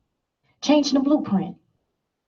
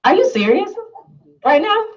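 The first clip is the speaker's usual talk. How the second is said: climbing much higher into mock disbelief; incredulous husband; 10 semitones higher than usual. Husband catching wife on Facebook at dawn, incredulous. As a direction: imitate husband's exasperated disbelief. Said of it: "my husband was like".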